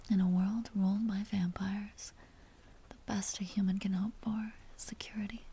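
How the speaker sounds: a soft, soothing whisper to a sleeping head on her lap; tender whisper. Acted. Vampire soothing the drowsy hunter on her lap, tender whisper. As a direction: hush him gently into sleep.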